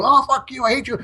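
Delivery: high-pitched mocking tantrum; mock outraged cursing. Mimics liberals cursing and fleeing.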